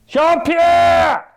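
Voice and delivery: very high, loud yell with a held last vowel; shouted call. Acted. Chef summoning his missing sous chef, shouted call. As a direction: yell for someone far away.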